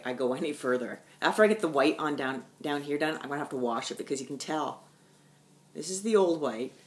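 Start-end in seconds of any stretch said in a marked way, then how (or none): none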